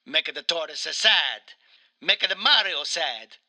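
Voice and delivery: mock-mournful Italian game character; Mario with Italian accent. Mario recounts his sad childhood, in a mock-mournful Italian accent. Plays Mario being interviewed.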